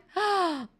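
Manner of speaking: high drawn gasp; sharp high intake of breath; shocked gasp. Real, not acted. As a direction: gasp in surprise. Reacts to a gifted sub.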